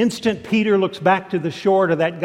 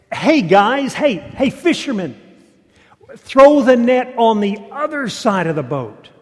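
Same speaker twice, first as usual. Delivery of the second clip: loud, higher hail across the water; calling out; 6 semitones higher, 7 dB louder than usual. Acted. Man on the shore calls out to the fishermen, loud and high. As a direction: shout it out to boats offshore. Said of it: "he calls out to them".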